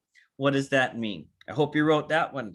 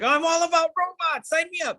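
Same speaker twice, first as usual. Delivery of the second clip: high, bright-eyed convert; much higher mock enthusiast; eager prospect voice; 13 semitones higher than usual. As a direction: play someone suddenly sold on robots.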